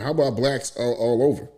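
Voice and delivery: usual speaking voice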